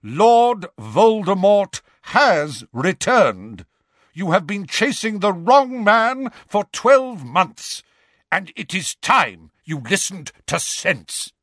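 thunderous voice. Acted. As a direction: thunder the challenge. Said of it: "in a thunderous voice".

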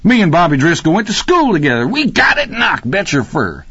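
high, excited rush of words; excited, high-pitched boasting; thrilled. A narrator's reading. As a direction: gush in a high voice about an old school friend. Rocky thrilled at spotting old schoolmate.

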